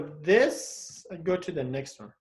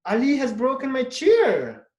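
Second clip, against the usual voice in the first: "Ali has broken my chair" said higher and louder, hitting "chair"; exclamative; 6 semitones higher, 5 dB louder than usual. Shown as a demonstration. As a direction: exclaim it in dismay. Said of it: "Just you change your voice, intonation".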